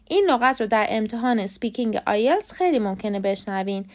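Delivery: ordinary delivery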